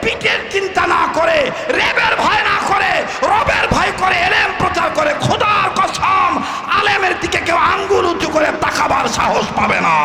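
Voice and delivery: high, loud sermon shout; fiery preaching. Acted. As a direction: thunder it at the crowd. Preaching at full force.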